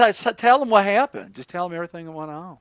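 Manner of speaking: high southern voice, quickening; southern accent. Acted. Host urging co-host to tell all, southern accent. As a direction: coax him in a southern accent. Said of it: "That accent's infectious".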